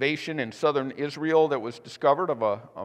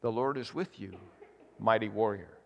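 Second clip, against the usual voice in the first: softer, lower and calm; calm divine reply; 6 semitones lower, 6 dB quieter than usual. Acted. Angel to frightened Gideon, soft calm reassurance. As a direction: answer gently, unmoved by the excuses. God's quiet repeated reply.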